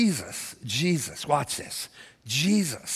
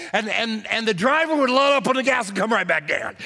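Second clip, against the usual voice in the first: high, loud storytelling preacher; preaching a story at full force; 8 semitones higher, 4 dB louder than usual. Acted. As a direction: build the story into a climax. Retells ditch story at full force.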